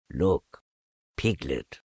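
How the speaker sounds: low donkey voice, softening; Eeyore, proud. A narrator's reading. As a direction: point it out low, quietly proud.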